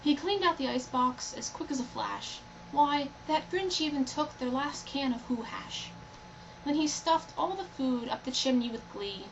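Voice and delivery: usual speaking voice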